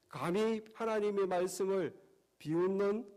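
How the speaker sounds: normal talking voice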